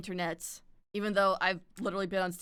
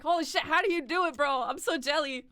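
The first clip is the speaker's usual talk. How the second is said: high, hurried gush; playful envy; 7 semitones higher, 4 dB louder than usual. Retold or imitated. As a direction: blurt out your mock jealousy. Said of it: "I'm just like".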